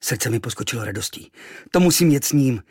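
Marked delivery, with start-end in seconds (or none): 1.7-2.6 eager. Acted. Boy thrilled.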